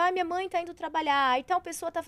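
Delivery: a hint of a kid thinking aloud; child reasoning. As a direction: lightly act out a child's thought.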